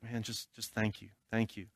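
soft, choked-up retelling of a friend's thanks; a quiet hint of tears; crying thanks. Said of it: "he just starts crying".

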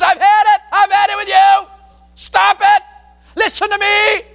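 high, loud exasperated shouting; yelling at kids. Shown as a demonstration. Parent yelling at the kids, angry and loud. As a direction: lose your temper at the kids. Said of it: "You raise your voice at your kids".

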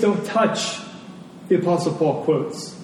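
ordinary delivery

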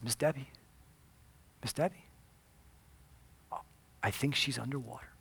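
soft, tentative check for a response; subdued retold operator; hushed calling. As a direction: call her name quietly, then realize. Operator calls to silent caller.

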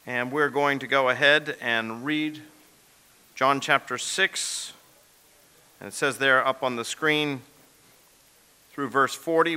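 usual speaking voice

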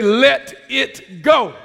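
loud, slow word-by-word call; loud, measured chant-like exhortation; preacher's call-out. Shown as a demonstration. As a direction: lead the congregation, spacing out each word. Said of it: "Somebody say".

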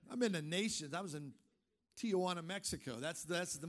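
ordinary talk, nothing marked

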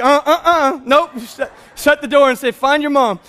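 laughing, loud, recoiling nope; laughing mock disgust; squeamish refusal. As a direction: back away from the mess with a laugh. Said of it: "you'd be like".

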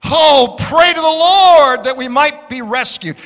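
a voiced plea from frightened Israelites; pleading. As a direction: beg Samuel to pray for them. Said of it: "they pleaded with Samuel".

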